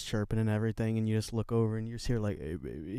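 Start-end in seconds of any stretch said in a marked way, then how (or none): 2.4-3.0 low, quiet raspy morning voice; sleepy rasp, pitched down and hushed. Acted. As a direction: put on a soft, deep rasp. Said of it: "who has a very raspy voice".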